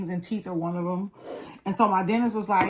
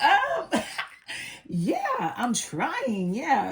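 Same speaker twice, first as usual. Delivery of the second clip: giggling, much higher reply; bashful laugh; 6 semitones higher, much slower than usual. Retold or imitated. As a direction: laugh shyly, pitch way up. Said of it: "I was like".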